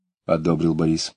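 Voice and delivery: usual speaking voice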